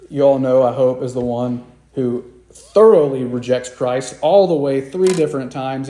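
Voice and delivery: ordinary delivery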